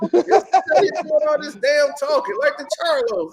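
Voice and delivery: ordinary talk, nothing marked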